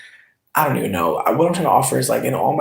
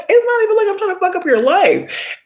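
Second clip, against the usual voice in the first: squeaky high-pitched disbelief, stretched out; exasperated high retort. Retold or imitated. To a rude dating-app man, high-pitched exasperated disbelief. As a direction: voice shoots way up in mock exasperation. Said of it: "And I'm just like".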